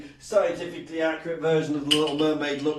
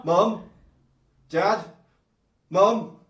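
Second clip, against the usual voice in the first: raised, questioning calls with long gaps; tentative calling out; 4 semitones higher, 7 dB louder, much slower than usual. Acted. Slug calling its parents to come out, tentative calls. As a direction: call for each parent and wait.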